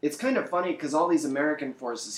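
ordinary talk, nothing marked

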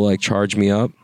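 ordinary delivery

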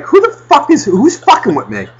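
high-pitched outraged demand; hammed-up outrage, looking for the culprit; indignant golfer. Golfer hit by a stray fan, mock indignant. Acting out baffled, annoyed golfer.

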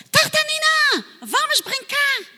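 very high, loud shout across the sea; a boy swimming shouts to a friend on the shore; calling from afar. Acted. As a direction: call out to a friend far away. E de longe.

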